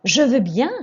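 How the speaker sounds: teacher models eager agreement; keen. Shown as a demonstration. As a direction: accept gladly, with energy. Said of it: "Écoutez et répétez".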